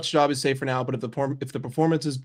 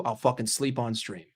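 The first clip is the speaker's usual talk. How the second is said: ordinary delivery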